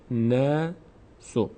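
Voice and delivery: "ناسو" slowly, long light æ in the first syllable; thin vowel. Shown as a demonstration. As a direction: stretch the first vowel of "ناسو", kept front. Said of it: "نا هم پر خونده نمیشه".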